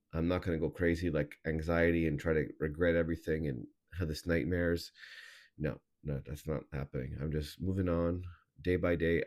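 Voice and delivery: usual speaking voice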